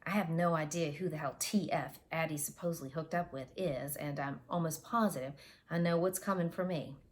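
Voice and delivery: plain reading voice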